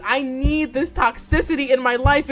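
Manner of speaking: ordinary delivery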